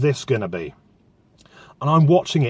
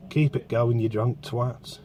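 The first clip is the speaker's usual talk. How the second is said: muttered aside; 3 semitones lower, 7 dB quieter, slower than usual. Real, not acted. Aside at drunk passers-by.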